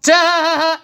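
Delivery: a loud, high, mocking "Duh!" with a laugh. Real, not acted. Reader laughing at the querent's wishful thinking, loud mock. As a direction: blurt it out like a scornful laugh.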